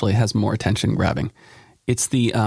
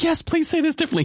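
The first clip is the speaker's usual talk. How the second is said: much higher, mock-eager editor voice; a high, lightly hammed editor; editor's eager reply. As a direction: parrot the editor's keen yes. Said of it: "He's like".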